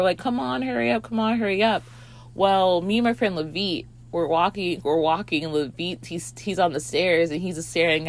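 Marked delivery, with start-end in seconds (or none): 0.2-1.8 higher, hurried-along chant; impatient urging. Retold or imitated. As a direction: urge them through a closed door. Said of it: "banging the door".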